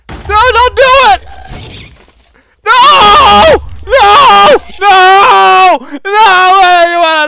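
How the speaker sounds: high, loud anguished cries of no; desperate screams. Real, not acted. Gamer screaming at a ghast about to destroy his stuff, desperate. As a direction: scream it out in despair.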